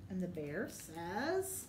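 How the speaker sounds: drawn-out slow lead-in, rising to invite the answer; slow prompting. Shown as a demonstration. Teacher slows down to prompt children to answer. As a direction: stretch it out so the kids jump in. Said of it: "what's he say?".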